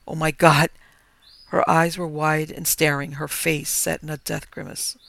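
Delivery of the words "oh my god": loud horrified exclamation; horror. Acted. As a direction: gasp it out in shock. Finds wife's body.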